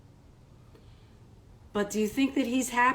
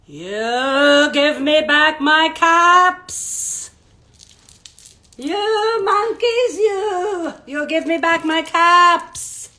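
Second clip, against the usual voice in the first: slow, sing-song scolding, higher and louder; scolding peddler; 6 semitones higher, 8 dB louder, much slower than usual. Acted. Peddler scolding the monkeys up in the tree, sing-song telling-off. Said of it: "shaking his finger at them".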